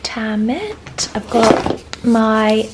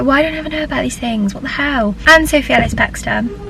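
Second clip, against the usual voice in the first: ordinary talk, nothing marked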